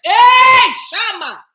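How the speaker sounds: piercing high, very loud cry; explosive outburst, falling off on the second word; spirit shout. Real, not acted. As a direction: shout it out with full force. Sudden spirit exclamation mid talk.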